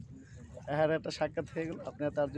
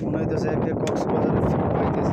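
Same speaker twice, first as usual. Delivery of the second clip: loud, raised voice over wind; shouting over wind; 8 dB louder than usual. Real, not acted. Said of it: "বাতাসে কিছু শোনা যাচ্ছে না".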